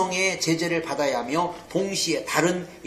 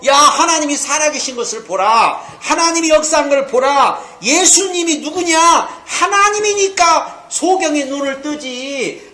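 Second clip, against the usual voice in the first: loud, high preacher's cry, driving each phrase home; forceful proclamation; 7 semitones higher, 6 dB louder than usual. Acted. Preacher proclaims who Jesus is to doubters, forceful and loud. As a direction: proclaim it at full force to the crowd.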